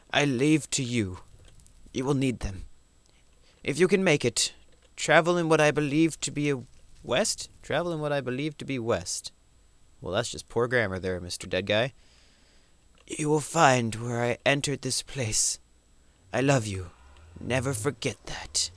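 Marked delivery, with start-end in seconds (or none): 0.1-9.3 dying explorer's farewell, lightly acted; storyteller voice. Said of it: "storyteller voice". 13.1-18.7 slow, solemn reading, whispered ending; tender farewell of a dead man; storyteller voice. Pretend. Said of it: "storyteller voice".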